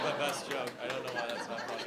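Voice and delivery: usual speaking voice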